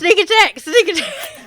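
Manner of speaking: high, loud yell through laughter; yelling battle cry. Acted. Game master voices a player running into the fight, yelling. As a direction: yell it out as you charge. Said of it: "he runs yelling out".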